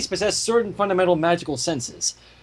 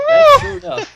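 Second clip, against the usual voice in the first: much higher and louder repeat; squeaky repeat; 10 dB louder than usual. Acted. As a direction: squeak it out in a silly high voice.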